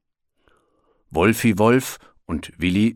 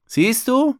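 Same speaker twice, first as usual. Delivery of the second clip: know-it-all; 4 dB louder than usual. A narrator's reading. Wolf teasing the bear.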